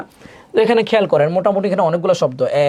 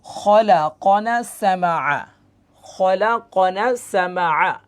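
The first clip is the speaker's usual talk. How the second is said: very slow, drawn-out syllables; measured Quran-class sounding-out; joined-up spelling; 3 semitones higher, much slower than usual. Shown as a demonstration. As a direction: blend the letters into a slow recitation. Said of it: "সব মিলে কি হয়".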